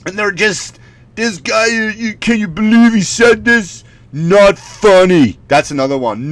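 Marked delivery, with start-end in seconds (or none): 1.2-5.4 high, scandalised commenter impression; mock the offended online crowd; outraged Facebook commenter. Facebook people attacking comic, high mock outrage. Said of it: "So the Facebook people, they're on there".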